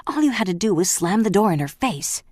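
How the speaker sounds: the master still raging at the housekeeper; angry yell. Acted. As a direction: keep berating her. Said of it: "he yelled".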